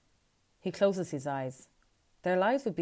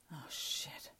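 breathy whispered dismay; whispered curse; 11 dB quieter than usual. A narrator's reading. Luca seeing the men outside, whispered dismay. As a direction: hush the curse as it dawns on you.

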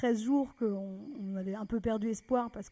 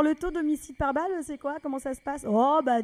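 ordinary talk, nothing marked

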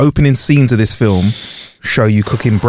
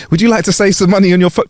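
cold caller impression; 9 semitones higher, faster than usual. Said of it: "Usually it's like".

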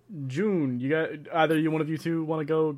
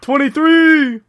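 high, loud cheer at the roll; loud delighted announcement; excited shout; 11 semitones higher, 11 dB louder, much slower than usual. Real, not acted. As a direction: call it out thrilled. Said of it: "announces dice roll".

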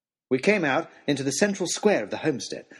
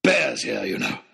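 gruff, urgent outburst; wild hunter; 2 semitones lower than usual. A narrator's reading. Wild Oxshott explains himself to the crowd, urgently. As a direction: blurt it like a half-mad hunter.

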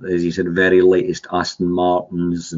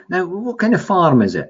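much higher, curious mock interviewer; radio interviewer; 10 semitones higher than usual. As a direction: pitch it up like the keen radio man. Radio interviewer quoted in story.

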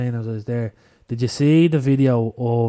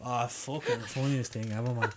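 ordinary talk

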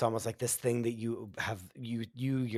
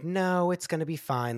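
mock reassuring; 8 semitones higher, 4 dB louder than usual. Friends brushing off cancer fear.

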